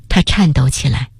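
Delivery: ordinary delivery, nothing marked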